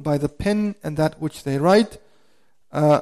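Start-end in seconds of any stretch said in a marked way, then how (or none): none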